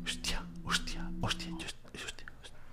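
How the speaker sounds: breathless whispered hostia, barely audible; frightened whisper. Real, not acted. Streamer spooked by a moving object, whispering in fright.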